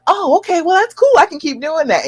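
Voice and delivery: high, bright surprised voice of a pleased husband. Pretend. Wife coaching viewers voices the husband going high and pleased. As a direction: pitch up as the husband catching on.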